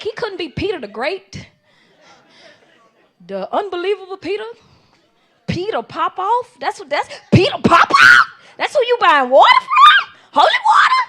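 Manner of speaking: loud, high, laughing outrage; laughing disbelief. Retold or imitated. Mocking the fake preacher to her friends, laughing loud. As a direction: shout it through laughter, incredulous.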